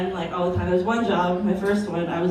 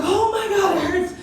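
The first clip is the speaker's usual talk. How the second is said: high, laughing mock yelp of pain; hammed-up "oh my God" from a burn; coworker in pain; 10 semitones higher than usual. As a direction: imitate the coworker's pained cry. Coworker burns her armpit.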